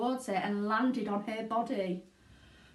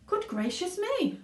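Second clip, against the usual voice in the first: high, startled exclamation; a father startled by the fall; surprised father; 10 semitones higher than usual. A narrator's reading. Father watching wife fall.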